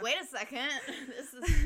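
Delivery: breaking into laughter mid-sentence; laughing. Real, not acted. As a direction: laugh through the words. Laughing at a jarring word.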